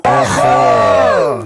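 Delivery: long loud shouted Aha. Shown as a demonstration. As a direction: bellow Aha as a warning. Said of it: "Il faut crier Aha". (Aha crié fort pour alerter)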